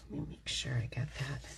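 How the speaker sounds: soft unvoiced whisper to self. Real, not acted. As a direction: breathe it to yourself while checking. Muttering to self while working.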